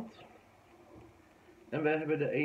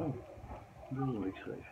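usual speaking voice

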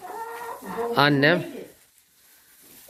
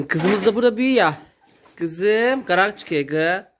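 talking to a pet; 6 semitones higher than usual. Real, not acted. Coos at the calf.

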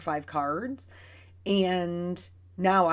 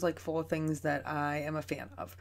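usual speaking voice

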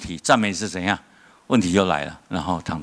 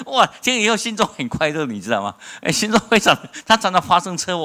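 excited joy; 10 semitones higher than usual. Real, not acted.

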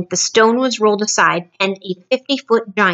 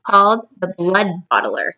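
ordinary delivery

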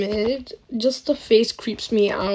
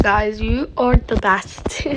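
ordinary talk

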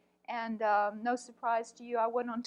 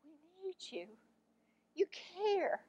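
tearful; 5 semitones higher, 6 dB quieter than usual. Retold or imitated. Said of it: "he was crying".

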